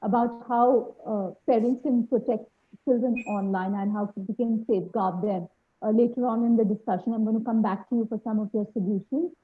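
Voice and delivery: very quiet, faint voice; speaking too softly to hear; barely audible. Real, not acted. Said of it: "your voice is very low".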